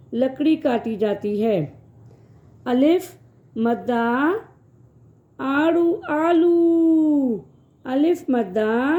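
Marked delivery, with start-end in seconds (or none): 6.1-7.4 "آلو" with a long stretched آ; long drawn آ in "آلو", teacher demo. Shown as a demonstration. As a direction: hold the آ of "آلو" slowly. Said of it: "اس کی آواز تھوڑی کھچ کے آتی ہے".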